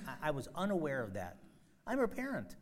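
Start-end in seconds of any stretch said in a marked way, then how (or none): 1.9-2.6 incredulous. Real, not acted. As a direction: pitch jumps high in disbelief. Exclaims in disbelief.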